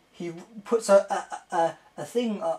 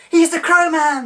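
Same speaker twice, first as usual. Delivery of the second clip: much higher and louder squeal; excited fan squeal; 11 semitones higher, 8 dB louder than usual. Retold or imitated. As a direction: relive the thrill of spotting him. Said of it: "I goes".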